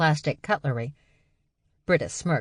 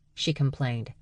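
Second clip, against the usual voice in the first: plain reading voice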